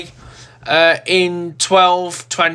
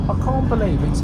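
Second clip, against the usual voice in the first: usual speaking voice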